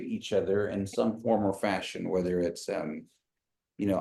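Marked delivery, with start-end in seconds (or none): none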